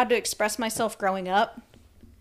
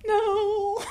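mock sob no; 10 semitones higher than usual. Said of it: "they're like".

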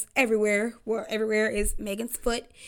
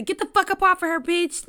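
high, louder mock yell at the girl; 8 semitones higher, 5 dB louder than usual. Retelling Tory yelling at the girl, mock yell. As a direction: shout it like pulling someone off a fight. Retold shout at girlfriend.

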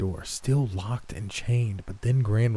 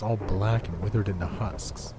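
ordinary delivery, nothing marked

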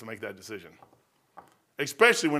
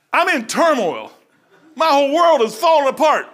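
hammed-up despair, higher and louder; mock turmoil; 8 semitones higher, 7 dB louder than usual. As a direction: play the drama, tongue in cheek. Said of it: "Really, I'm joyful inside".

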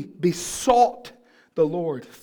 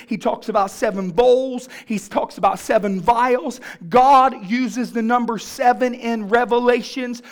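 preaching at full force; 6 semitones higher, 10 dB louder than usual. Acted. Pastor drives home Paul's desperate prayer, preaching at full force. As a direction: build it into a roar.

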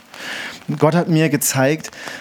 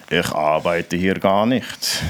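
low, slow deadpan reply; deadpan stranger; 7 semitones lower, slower than usual. Retold or imitated. Stranger telling the preacher he doesn't work here, low and slow. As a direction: flatly let the man down. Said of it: "dann sagt er".

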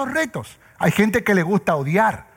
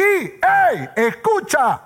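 calling out; 7 semitones higher, much slower than usual. Real, not acted. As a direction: snap the room awake with one shout. Preacher calls out to congregation.

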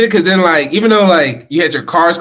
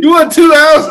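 mock outrage; 12 semitones higher, 6 dB louder than usual. Host mocking the double loss.

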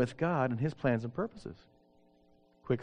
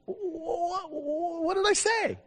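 high, slow anxious question; a bit scared he said something wrong; worried; much slower than usual. Retold or imitated. Pastor fearing his poor Spanish offended her, high and slow.

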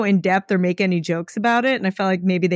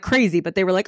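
usual speaking voice

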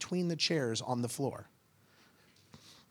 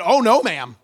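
loud, high comic rebuke; emphatic refusal; 8 semitones higher, 16 dB louder than usual. Retold or imitated. Pastor correcting girl on cruise, loud mock rebuke. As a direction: shut her down at full volume.

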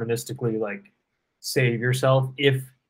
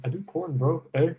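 higher-pitched, badly imitated Canadian accent; horrible Canadian accent; 5 semitones higher than usual. Podcaster imitating his gym acquaintance to his co-host, bad Canadian accent. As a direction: do a poor mock Canadian, lilting up. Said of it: "that was a horrible Canadian accent".

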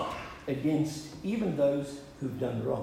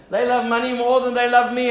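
louder and higher, hammering LOVE; preaching emphasis; 5 semitones higher, 9 dB louder than usual. Shown as a demonstration. Pastor repeats the family joke, now preached loudly. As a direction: preach it out with full force.

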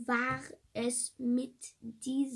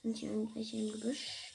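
ordinary talk, nothing marked

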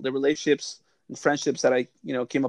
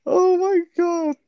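high, loud, drawn-out cry of amusement; laughing exclamation; 5 dB louder, much slower than usual. Real, not acted. Cracking up at a friend's story, high amused exclamation. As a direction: squeal it slowly, overcome with laughter.

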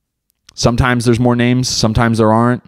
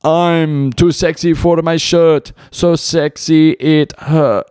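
slow, higher, hammed-up crooning; mock pained singer; 7 semitones higher, much slower than usual. Retelling the singer on stage, hammed-up pained crooning. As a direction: belt it like a hurting pop star. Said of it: "he's like".

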